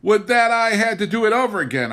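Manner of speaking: high, loud and fast bitter sigh; regretful and sharp, full of grievance; bitter reflection. A narrator's reading. Wife barricading the door, speaking bitterly to herself. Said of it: "I reflected bitterly".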